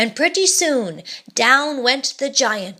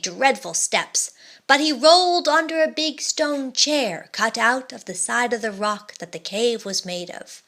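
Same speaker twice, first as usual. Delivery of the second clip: ordinary delivery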